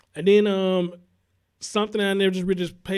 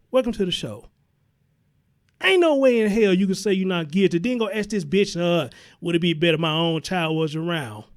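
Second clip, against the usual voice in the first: ordinary talk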